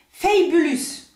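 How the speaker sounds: Fabulous with an English "fay" first syllable, French-tinged; attempted English accent. Shown as a demonstration. Vlogger trying the polish's English name, attempted English accent. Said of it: "mon accent anglais est, est complètement nul".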